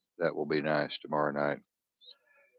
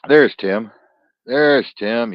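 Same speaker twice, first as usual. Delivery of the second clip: loud, higher call as the guest appears; loud happy welcome for Tim; excited greeting; 5 semitones higher, 11 dB louder, much slower than usual. Real, not acted. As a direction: hail him happily, louder and higher.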